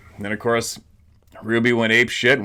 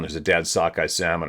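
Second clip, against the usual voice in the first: ordinary talk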